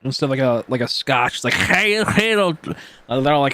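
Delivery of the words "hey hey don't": put-on higher Scottish accent; quick Scottish "hey, hey". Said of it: "type of sound Scottish people do".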